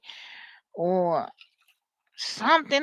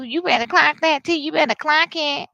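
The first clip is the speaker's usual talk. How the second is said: loud, higher catchphrase; sassy queen talk; 5 semitones higher, 5 dB louder than usual. Acted. Showing how somebody may talk, sassy and loud. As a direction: imitate someone clocking the tea. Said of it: "Somebody may talk like this too".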